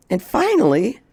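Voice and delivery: usual speaking voice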